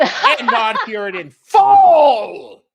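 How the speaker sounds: slow, loud, outraged shout; indignant shout. Real, not acted. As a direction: bellow it at the absent poster. Ranting about the message.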